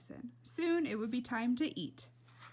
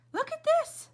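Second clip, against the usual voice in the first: much higher and louder, delighted; excited call; 8 dB louder than usual. Acted. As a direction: grab the whole room's attention. Tootie calls everyone to look.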